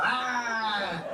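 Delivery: long, high "aah"; drawn-out mock trucker holler; trucker yell. Pretend trucker's yell repeated.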